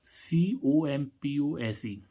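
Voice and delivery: letters spelled out very slowly; slow dictation for students; slow spelling. Shown as a demonstration. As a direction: spell सीओएमपीओएसई letter by letter, drawn out. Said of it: "जैसे हम देखें".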